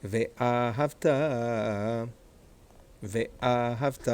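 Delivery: "ואהבת" with A marked and final TA held long; stress by accent marks. Shown as a demonstration. Teacher modelling the correct word for students, held final stress. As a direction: stretch the last syllable of "ואהבת", ve-A-havTAAA. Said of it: "and this is an accent mark".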